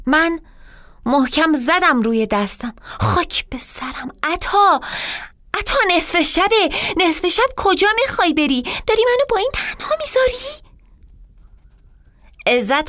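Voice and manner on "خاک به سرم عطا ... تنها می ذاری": climbing higher and faster in panic; panicked plea. Acted. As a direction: beg him not to go. Wife panics as husband leaves.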